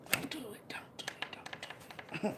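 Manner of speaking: breathy whispered warning. Real, not acted. Warning her viewers in a hushed whisper. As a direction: whisper it like a secret.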